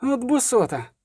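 high indignant cry; shout on the run. A narrator's reading. Fleeing bard curses the robbers, high shout. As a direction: cry out high while running, not full volume. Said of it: "крикнув на бігу Любисток".

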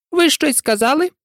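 high, very polite question through a frown; frowning but polite. A narrator's reading. Mouse addressing the Lory who interrupted, frostily polite. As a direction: ask with stiff courtesy, pitched high. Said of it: "насупившись, але дуже ввічливо".

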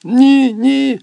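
very high and loud, frantic; frantic shout. Acted. Boy refusing his mother, frantic shout. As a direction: scream no, out of control. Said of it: "несамовито вигукує".

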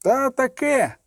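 high-pitched narrated exclamation; mild shout from the crowd; sudden cry. A narrator's reading. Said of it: "крикнув раптом один із людей Імбри".

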